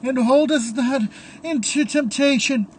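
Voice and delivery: high, loud tearful prayer; crying priest. A narrator's reading. Crying priest prays in the church, high and loud through tears. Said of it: "The priest crying still".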